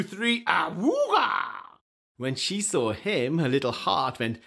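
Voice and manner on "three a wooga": high slow shouted wooga; boy mouse seeing the girl, excited wooga shout; wolf-whistle wooga. Acted. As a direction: let the eyes pop out. Boy mouse falling in love.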